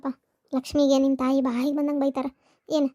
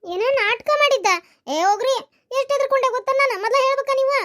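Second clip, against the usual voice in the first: upset scolding; 10 semitones higher than usual. Acted. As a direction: let the fright spill out as a scold. Wife learns illness was faked. (ಗಂಡನಿಗೆ ಹೆದರಿಕೆ ತುಂಬಿದ ಜೋರಿನ ಗದರಿಕೆ)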